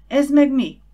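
loud, high-pitched burst of surprise; startled question. A narrator's reading. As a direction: blurt it out, taken aback. Startled question at strange sight. (meghökkent, hangos kérdés)

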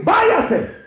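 high, abrupt cry of dismissal; shouted order. Acted. Preacher ordering someone out of church, shouted order. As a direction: send them away with a high shout. Acted order to leave in sermon.

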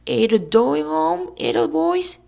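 high, slow, loud falsetto; drawn-out shrill teasing; yelled girlish taunt. A narrator's reading. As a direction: yell it in a mock-girlish voice. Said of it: "yelled Billy".

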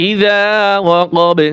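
ইযা ওয়াকাবে with long "zaa" and popped b; qalqalah stop. Shown as a demonstration. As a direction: recite ইযা ওয়াকাবে, ending on a bouncing b. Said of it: "কলকলা হবে". (ইযা ওয়াকাবে, শেষে বা-তে কলকলা)

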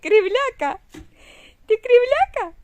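high loud affectionate teasing; playful teasing. Real, not acted. Mother teasing her grimacing baby, high and playful.

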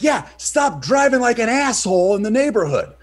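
high, loud shout back; retold angry retort. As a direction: yell it back at a bad driver. Shouts back at driver in story.

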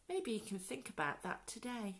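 ordinary delivery

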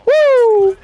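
high, drawn-out whoop; celebrating whoop. Real, not acted. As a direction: let out a high, elated whoop. (alarido agudo de celebración)

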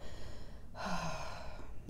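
soft unvoiced sigh of regret. Real, not acted. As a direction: let out a quiet, breathy sigh. Regret over the drawer she didn't buy.

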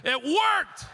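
high-pitched comic outrage, building to a shout; mock-furious disbelief, rising sharply; exasperated mad. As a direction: yell it like you can't stand that it worked. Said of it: "I got so mad".

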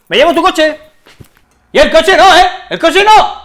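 high, loud alarmed shout; shouting after someone. Acted. Man shouts about the car being taken, shouting in protest. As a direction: yell in protest from a distance.

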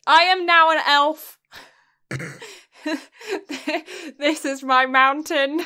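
loud, grand high elf voice; larping elf. Pretend. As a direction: proclaim it like a fantasy elf. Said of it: "I always feel like I'm larping".

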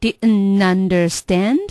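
very slow "didn't understand" as di'n-nunderSTAND; linked drill echo. Shown as a demonstration. Learner echoing the teacher, slow linked "didn't understand". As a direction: repeat "didn't understand" slowly without t. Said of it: "我们来分段练一下".

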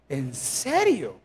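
low, very slow; sarcastic disbelief. Acted. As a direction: drag it out mockingly. Mocking a husband's excuse.

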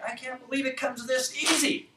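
high-pitched mock amazement; replayed amazement, pitched well up; amazed disbelief. Acts out own amazed reaction.

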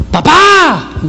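high, stretched, falling holler; younger son calling. Acted. Nine-year-old summoning his father, calling out high. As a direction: holler for Dad with a long last vowel. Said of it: "y el otro sí dice".

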